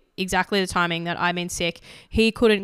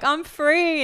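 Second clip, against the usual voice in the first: higher, joyful cry of freedom; 9 semitones higher than usual. Retold or imitated. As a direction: sing it out in relief. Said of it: "I was like".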